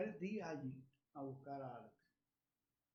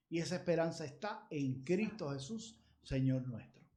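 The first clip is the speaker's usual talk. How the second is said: exhortación fuerte y sonora a la congregación; predicación ferviente; 8 dB louder than usual. Acted. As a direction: clamar con fuerza, como en el púlpito. Predica con fuerza. (predicador exhortando a los fieles, voz alta y ferviente)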